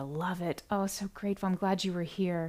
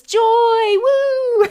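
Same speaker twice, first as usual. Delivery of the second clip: cheer; 15 dB louder than usual. Retold or imitated. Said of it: "Jeanette says".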